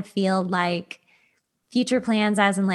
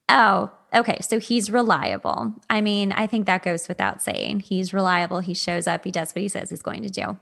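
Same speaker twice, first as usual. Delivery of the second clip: ordinary talk, nothing marked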